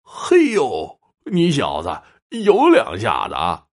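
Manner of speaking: high, drawn-out impressed exclamation; amazed. A narrator's reading. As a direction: start high in astonishment, then stretch the praise. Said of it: "惊异道".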